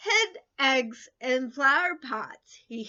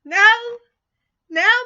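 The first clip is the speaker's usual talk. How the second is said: exclaimed now; 7 semitones higher, 11 dB louder than usual. A narrator's reading. Exclaimed story opener.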